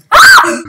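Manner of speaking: sudden shrieking scream, very high and loud; startled scream. Real, not acted. Gamer jumped by an enemy, screaming in fright. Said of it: "That scream, though".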